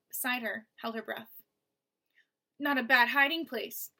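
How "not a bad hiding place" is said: a hint of a rasping, louder menacing voice; lightly voiced rasping thug; rasping villain. Basta taunting Mo through the wall, light rasping menace. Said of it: "the cat-like voice".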